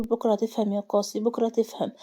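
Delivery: ordinary delivery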